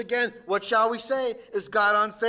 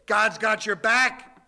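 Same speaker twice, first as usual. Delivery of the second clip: louder, slow promise; preaching at full force; 2 semitones higher, 4 dB louder than usual. Acted. Preacher assuring the congregation that God has their back, louder. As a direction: reassure the whole room.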